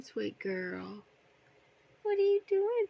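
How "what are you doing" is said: high and louder, squeaky pet voice; excited high pet talk. Real, not acted. Baby talk to bird.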